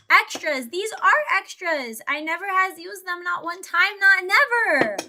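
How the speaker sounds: hammed-up childish boast; playful silly voice. Playful silly grammar about extras.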